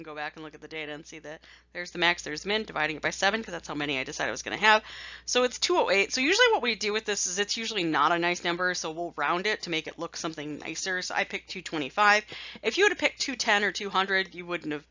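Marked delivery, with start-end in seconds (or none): none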